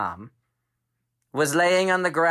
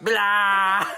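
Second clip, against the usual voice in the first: spitting blah; 6 semitones higher, 4 dB louder than usual. Acted. Spitting out skunk taste.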